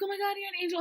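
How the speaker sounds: high, quick gush; roommate's awe, retold briskly; imitated amazement. Same quote retold quicker.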